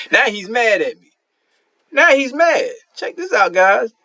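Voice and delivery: high, amused cry to viewers; gleeful disbelief. Real, not acted. Gamer showing viewers the rival's rage, gleefully high. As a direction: call the chat over, delighted. Reacting to rival's angry message.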